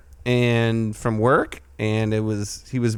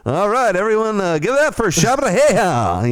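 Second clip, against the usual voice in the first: club DJ announcer; 7 semitones higher, 4 dB louder than usual. Retold or imitated.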